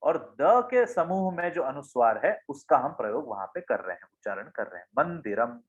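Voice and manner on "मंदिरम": मंदिरम with a clear न sound before द; word demo. Shown as a demonstration. Teacher showing students the न in मंदिरम. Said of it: "मंदिर में हम न का उच्चारण कर रहे".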